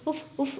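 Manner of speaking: quick soft puffs of air on a burnt hand; blowing on hand. Acted. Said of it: "అని అంటూ ఊదేసి".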